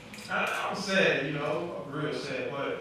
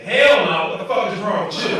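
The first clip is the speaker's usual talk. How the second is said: loud, higher mock outrage; auntie's outraged yell; 4 semitones higher, 13 dB louder than usual. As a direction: bark it back like a shocked auntie. Auntie retorts in standup story.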